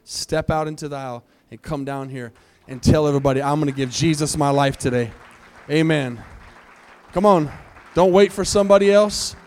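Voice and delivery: loud, urgent call to come forward; preacher calling out over music; rousing altar call. Acted. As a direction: shout them down the aisles.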